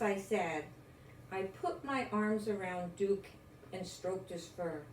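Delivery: ordinary delivery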